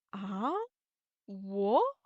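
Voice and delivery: demonstrated puzzled query, rising sharply; confused, surprised question. Shown as a demonstration. Teacher models second tone through surprised questions, rising. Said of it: "you are confused or surprised".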